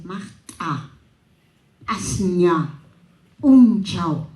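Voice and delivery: "magta", "asña", "punchao" with glottal catches; glottalized Cusco way. Shown as a demonstration. Showing the class the Cusco glottalized quechua, slow and careful. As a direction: clip each word with a glottal stop. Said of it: "la glotalización".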